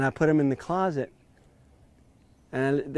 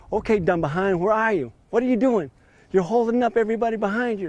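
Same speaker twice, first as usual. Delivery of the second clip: higher and louder, fast at the end; retold yell; 7 semitones higher, 3 dB louder than usual. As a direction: bark it at someone holding up the course. Owner yelling at lost golfer.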